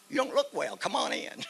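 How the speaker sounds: high-pitched comic reply; retold welcome. Soldier to the man thought dead, retold high-pitched welcome. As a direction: lift the voice high for the punchline.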